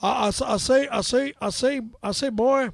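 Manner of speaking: loud, stammering cartoon rooster; Foghorn Leghorn. Shown as a demonstration. Host calls for cartoon voice, Foghorn Leghorn. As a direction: bluster the repeated words out loudly. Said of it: "Foghorn Leghorn".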